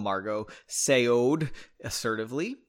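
usual speaking voice

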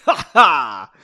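very loud acted "ha ha"; hammed-up laugh. A narrator's reading. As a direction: belt out a put-on laugh.

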